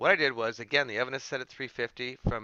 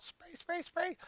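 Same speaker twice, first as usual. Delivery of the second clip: playful spray sound; 8 dB quieter, slower than usual. Pretend. Imitating the spray bottle.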